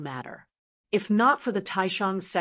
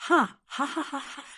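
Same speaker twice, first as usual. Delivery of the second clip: a burst of higher, mocking laughter that fades away; scornful laugh; 6 semitones higher than usual. Prisoner laughing in the sect master's face, mocking. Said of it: "What are you laughing at?".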